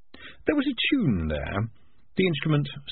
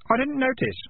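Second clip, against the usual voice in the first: put-on young boy voice. A narrator's reading. Keith answers the piper.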